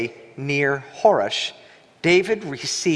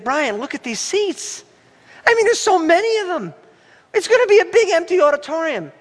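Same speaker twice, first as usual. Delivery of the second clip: much higher, rushing, rising alarm; retold worry; 13 semitones higher, 3 dB louder than usual. Pastor telling friend Brian the seats will sit empty, high fretful rush. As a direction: plead with a friend in mock dread.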